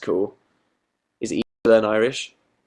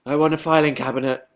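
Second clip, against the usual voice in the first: a hint of a pleading kid; mock a child's earnest wish to mum; lightly voiced demanding child; 3 semitones higher than usual. Same words as a child's demand.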